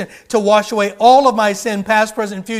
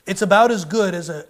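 ordinary talk, nothing marked

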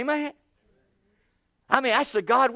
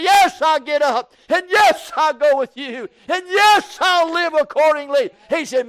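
higher and louder, shouting the joy; preaching at full force; 7 semitones higher, 7 dB louder than usual. Acted. Preacher rousing the congregation about the coming spring, full-force preaching. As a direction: lift the church with a rising cry. Preaching climax, voicing believers and Jesus.

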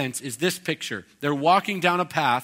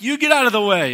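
loud, high demand to move; indignant shout at God; 7 semitones higher, 11 dB louder, faster than usual. Acted. People yelling at the Lord to move, in a loud indignant shout. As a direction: shove the words out like a furious man blocked in. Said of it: "It's like".

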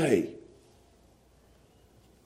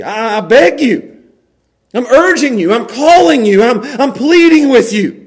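earnest pleading; 5 semitones higher, 7 dB louder than usual. Acted. Paul urging Timothy to stay, earnest plea. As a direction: beg him not to go.

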